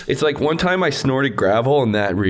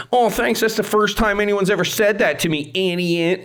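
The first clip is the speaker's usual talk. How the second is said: higher, snide mock thank-you; sarcastic kid; 7 semitones higher than usual. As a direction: throw the thanks back with a sneer. Kid's sarcastic retort.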